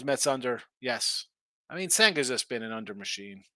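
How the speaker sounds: ordinary talk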